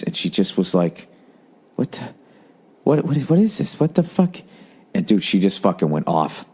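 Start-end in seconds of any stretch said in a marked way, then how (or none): 1.7-4.4 imitating her disappointment. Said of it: "the level of disappointment on her face".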